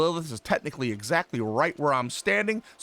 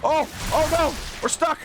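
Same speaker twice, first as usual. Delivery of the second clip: high-pitched panic; 11 semitones higher than usual. Real, not acted. Trapped with no mana.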